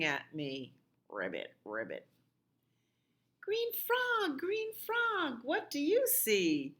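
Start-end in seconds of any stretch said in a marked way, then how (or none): 1.1-2.1 much lower croaking frog voice. Pretend. Frog noise for children. 3.4-6.7 much higher sing-song chant of the refrain. Acted.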